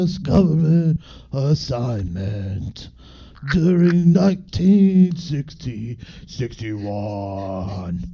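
slow, growled [name] reading voice; [name] impression. Reading a mystery book aloud as [name] on request. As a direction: drag every phrase out like [name]. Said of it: "read it in a [name] accent".

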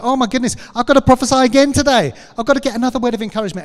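comic rushed "oh my goodness"; mock flustered excitement. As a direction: play a student panicking about daily homework. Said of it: "We're like".

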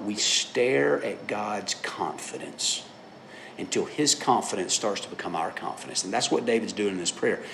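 normal talking voice

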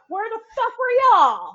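mock outraged laughing shout. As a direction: yell it while laughing.

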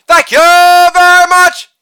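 high, loud, sustained catchphrase; showman's ringing thank-you; showy sign-off. Acted. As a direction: belt it out on one held high note.

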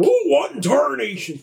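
high, twangy Yosemite Sam cry; old prospector. Retold or imitated. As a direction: holler it like a cartoon cowboy. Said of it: "Yosemite Sam".

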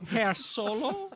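put-on slow, high German-accented address; mock German accent. Podcaster addresses a German Han Solo as a joke, mock German accent. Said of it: "There goes the German listening audience".

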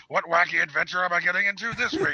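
mock Saturday-morning hero enthusiasm; wacky cartoon hero. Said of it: "In the cartoon, he was just like".

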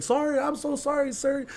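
high, meek imitation of a scared friend; pleading apology. Friend being thrown out by the dad, pleading.

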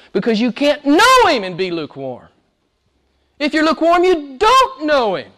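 high-pitched, impassioned warning; full-force preaching. Real, not acted. Preacher warning the lukewarm they don't know God, full-force preaching. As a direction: cry out the warning from the pulpit.

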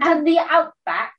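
hammed-up Australian twang; Australian accent. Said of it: "my Australian accent blows".

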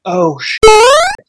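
high, loud startled yelp; startled curse. Retold or imitated. Comedian into the mic as rockets come, startled yelp. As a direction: blurt it out in sudden alarm. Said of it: "just was how I reacted".

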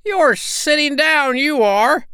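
very high, loud squeaky scorn; sneering little sandpiper; scornful. A narrator's reading. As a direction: accuse mockingly, stretching the words. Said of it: "said Nipsy in scorn".

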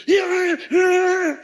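in-your-face coach; coach yelling. Retold or imitated. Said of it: "He's like right here".

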